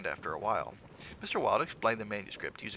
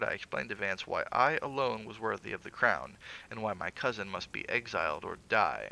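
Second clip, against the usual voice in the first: ordinary delivery, nothing marked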